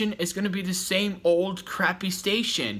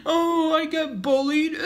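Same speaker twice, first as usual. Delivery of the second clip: very high whiny fake-crying voice; mock crybaby; 13 semitones higher than usual. Mocking a rival YouTuber as a crybaby, high whiny voice. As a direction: whimper it like a child to mock him. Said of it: "You act like a little girl when you cry".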